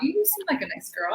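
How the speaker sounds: high, lightly voiced onlooker; imitated puzzled approval. People sizing up the dancer, high imitated puzzlement. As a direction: mimic someone gently puzzled, pitched well up.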